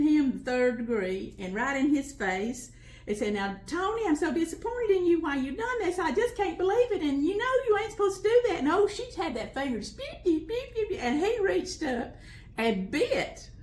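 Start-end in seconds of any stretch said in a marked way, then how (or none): 3.3-8.6 high, loud, speeding-up scold; mother scolding. Retold or imitated. Mother scolding son Tony on the back porch, loud and high. 10.2-11.0 finger-wag beeps. Retold or imitated. Imitating wagging finger.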